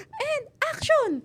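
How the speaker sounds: high, drawn-out cue; director's call. Retold or imitated. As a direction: call it slowly across the set. Imitates director calling action.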